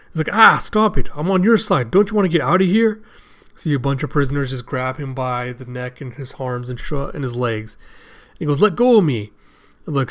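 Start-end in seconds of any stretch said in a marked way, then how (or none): 0.3-3.0 higher, louder acted pleading; agitated protest. Luffy protesting to attacking prisoners. 8.6-9.3 higher, louder acted demand; protesting yell. Grabbed character protests.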